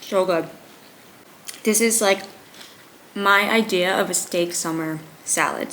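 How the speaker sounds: talking with her mouth full. Real, not acted. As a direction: speak around a mouthful of food. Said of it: "Sorry, I'm talking with my mouth full".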